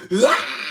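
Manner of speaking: short high mock shriek imitating a baby; a quick playful Nazgul shriek; ring wraith scream. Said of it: "it sounds like one of the ring wraiths".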